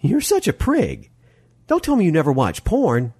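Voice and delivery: high-pitched, mocking woman's voice; teasing Molly. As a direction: tease him for being a prude, pitched well up. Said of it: "Molly laughed".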